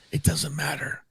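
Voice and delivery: put-on slurred drunk whisper. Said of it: "It's drunk whispering".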